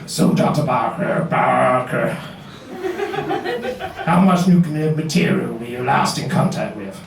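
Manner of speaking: lightly put-on American customs officer accent, slow and low; terrible American accent. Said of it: "other than my terrible accent".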